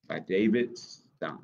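plain reading voice

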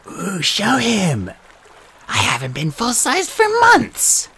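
high eager female snake. Acted. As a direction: coo it like a vain snake. Martha snake voice.

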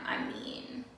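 breathy whispered aside. Real, not acted. Vlogger murmuring an aside to viewers, whispered. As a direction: drop to a whisper.

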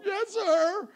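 high, loud whimpered answer; mock tearful child. Daughter admitting wrong to dad, mock tearful. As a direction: answer like a crying little girl.

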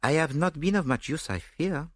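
a touch of apology; regretful. A narrator's reading. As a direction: admit it with gentle regret. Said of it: "he said regretfully".

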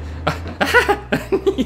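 high breathy squeal of laughter; high delighted laugh. Real, not acted. Delighted after the target.

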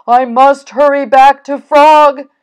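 loud, high cry of alarm; storybook character crying out urgently. A narrator's reading. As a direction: cry out in a panic to get back. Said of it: "he cried".

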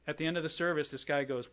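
ordinary delivery

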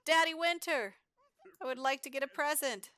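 put-on high, loud kid begging for a present; loud high-pitched playful begging; voicing the little boy. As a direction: hype it up like an excited kid asking Santa. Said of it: "He's like".

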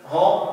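هم said with the nose held shut, no ghunna; nose pinched. Shown as a demonstration. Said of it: "وأحط إيدي على أنفي". (نطق هم والأنف مسدود)

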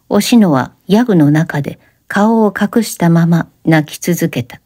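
ordinary delivery

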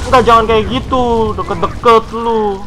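high, loud scared pleading. Real, not acted. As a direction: beg the monster to back off. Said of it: "takut gua".

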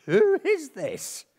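very high, slow mock wail; hammed-up awe-struck terror; mock terrified. Disciples aghast at the man in their boat, mock terrified.

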